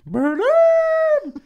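very high, loud squeaky creature cry, falling; Grimace gibberish. Retold or imitated. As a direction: belt it out like a cartoon creature. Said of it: "which means dialer".

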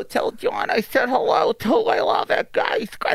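high-pitched, muffled boxer impression; Mike Tyson. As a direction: gush in a high voice into someone's chest. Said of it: "You hear muffled. You hear, just hear".

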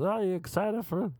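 very high mock Swedish-American lilt; hammed-up excited Swedish-ish accent; over-excited Uber driver. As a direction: imitate a too-keen driver, high and sing-song. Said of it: "That was kind of his voice".

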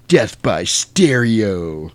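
very slow, drawn-out delivery; deadpan movie one-liner. Retold or imitated. Sam lying there after the vampire's death, slow one-liner. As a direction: stretch it out like a catchphrase. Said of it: "and Sam's like".

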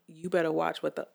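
normal talking voice

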